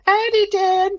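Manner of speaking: mock it in a silly high voice; mock squeaky howdy. Shown as a demonstration. Showing how Roger did not say it, mock squeak.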